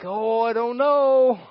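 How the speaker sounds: slow hesitant mock doubt; doubtful. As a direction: waver like unsure believer. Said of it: "we're like".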